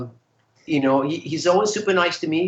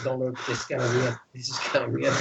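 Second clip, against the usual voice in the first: awkward mutter; 2 semitones lower, 6 dB quieter than usual. Retold or imitated. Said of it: "and like".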